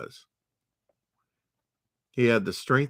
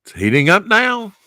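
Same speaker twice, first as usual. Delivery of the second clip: much higher and louder, thrilled; excited; 10 semitones higher, 10 dB louder than usual. Real, not acted. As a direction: cheer as the story heats up. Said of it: "excited about the climax".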